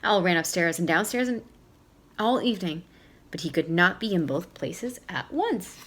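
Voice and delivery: ordinary delivery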